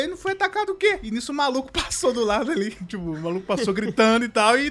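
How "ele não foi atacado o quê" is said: pitched way up in mock disbelief; retelling his own high, surprised denial; incredulous question. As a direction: act baffled by the accusation. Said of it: "Eu falei".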